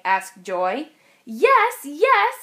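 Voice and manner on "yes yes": loud, rising cheer of yes; shouted yes. A narrator's reading. Kids answering the driver, loud excited shouts.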